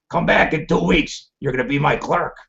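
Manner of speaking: imitating raspy Maury. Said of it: "he had this really raspy voice".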